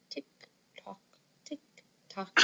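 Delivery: whispered tick-tock like a clock. Pretend. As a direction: breathe it out like a ticking clock. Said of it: "pretending to sound like a clock".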